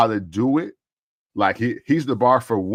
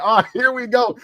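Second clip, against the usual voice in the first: high-pitched fan outburst, mock screaming; retold excited scream; 9 semitones higher than usual. Wrestling fan seeing his guy turn, excited high cry. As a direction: relive the thrill in a raised pitch. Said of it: "I started screaming 'cause I was like".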